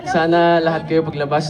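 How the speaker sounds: ordinary talk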